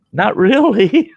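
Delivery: high, slow, loud sheepish admission; slow, high mock-innocent not really. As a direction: own up with a grin in the voice. Sheepish confession to the cop.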